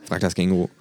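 ordinary delivery

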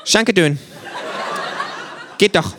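hoch und fröhlich. A narrator's reading. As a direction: zufrieden und munter. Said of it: "sagt das Känguru fröhlich". (Känguru bedankt sich fröhlich)

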